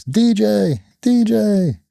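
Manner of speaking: high, drawn-out imitation of a little kid; child calling out. Young daughter calling her dad across the cage area, high mimicked call. And you just hear.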